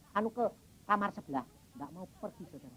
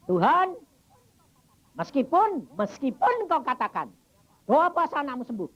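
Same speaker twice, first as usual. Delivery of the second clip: raised, louder pleading to God; father praying aloud over his dying child, raised and urgent; fervent prayer; 7 semitones higher, 5 dB louder than usual. Retold or imitated.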